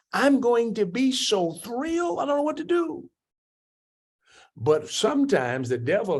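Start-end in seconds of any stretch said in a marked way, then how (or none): 0.1-3.1 high, gushing, giddy joy; playfully overjoyed, much higher; mock delight. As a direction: squeal it like the happiest person alive. Imagining the person correcting the flaw.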